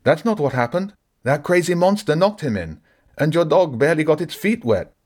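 high, pleading protest. Acted. Collier pleads with Jenny not to throw him, high and pleading. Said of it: "Collier pleaded".

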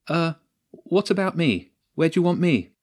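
high-pitched, questioning plea; unsure appeal. Acted. Jenny, left out of the plan, asks the captain, uncertain.

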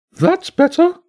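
very high, loud bird squawk, relieved. A narrator's reading. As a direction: squawk with relief like a freed parrot. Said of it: "the bishop said".

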